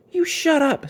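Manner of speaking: high sharp snap; snapped. A narrator's reading. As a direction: bite it off at him. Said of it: "snapped sister".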